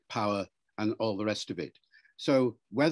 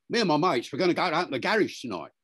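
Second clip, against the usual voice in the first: put-on young Cockney lad, higher and quick; traditional Cockney; 4 semitones higher, faster than usual. Shown as a demonstration. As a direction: run it together in broad Cockney. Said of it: "a Cockney from London".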